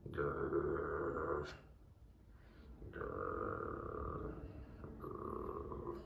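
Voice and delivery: low, soft rolling growl; playful wolf growl. Pretend. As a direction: pretend to be a wolf growling quietly.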